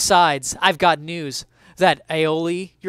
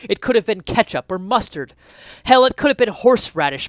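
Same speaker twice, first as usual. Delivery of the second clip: from louder to much higher and louder; building rant; 5 semitones higher, 8 dB louder than usual. Acted. Essayist rants about condiment history, building from loud to higher shout. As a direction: ramp up the indignation.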